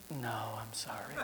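low, soft, slow apology; whispered gentle no. Retold or imitated. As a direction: let her down gently in a whisper.

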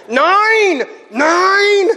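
high, loud mock-child scream; imitated screaming kids. Little kids block him on the diving-board stairs, screaming. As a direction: scream it like kids refusing to let him back. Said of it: "they just kept screaming at me".

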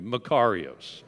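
Makarios said slowly as mə-KAR-ee-os, stress on the second syllable; Greek word. Shown as a demonstration. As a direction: say Makarios clearly for the congregation to repeat. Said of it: "Everybody say that with me".

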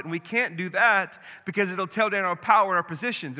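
cornered leaders, agitated and loud. Acted. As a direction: press the point with rising alarm. Same words, louder take.

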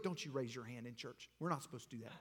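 low, hushed scolding; disapproving mutter. Acted. Churchgoer with a dirty look at worshipper, muttered disapproval. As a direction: scold under the breath. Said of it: "gives that dirty look".

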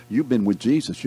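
ordinary delivery

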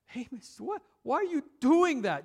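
put-on high pleading child's whine; a little brother giving up, pleading; whining kid. A hint of a little brother whining at his brother to stop. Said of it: "Finally, I said to him".

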